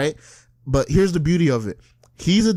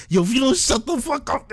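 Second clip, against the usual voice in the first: high, mock-exasperated warning; 8 semitones higher than usual. People in the stand-up fed up with bragger, exasperated. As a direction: imitate fed-up coworkers, pitched up.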